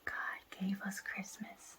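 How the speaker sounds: whispered prompt, no voice. Real, not acted. Mother whispers title to child.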